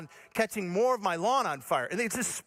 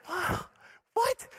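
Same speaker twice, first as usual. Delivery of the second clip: high-pitched wow, then higher; mock amazed disbelief; 11 semitones higher than usual. Storyteller watches firefighter finish instantly, high mock amazement. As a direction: marvel in a high squeak of surprise. Retold amazement at quick fix.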